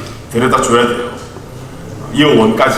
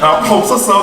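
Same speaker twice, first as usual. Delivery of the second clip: higher mock-innocent claim; teasing, innocent-sounding denial; playful denial; 6 semitones higher, 3 dB louder than usual. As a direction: fib to the child playfully. Father mock-denies to son.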